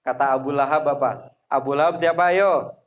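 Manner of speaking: ordinary talk, nothing marked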